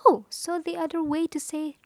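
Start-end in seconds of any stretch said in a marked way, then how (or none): none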